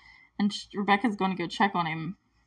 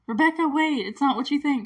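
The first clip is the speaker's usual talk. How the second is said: higher-pitched mock pleading; urgent plea; 6 semitones higher, 4 dB louder than usual. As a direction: imitate someone calling after her to explain. Retold plea after being caught.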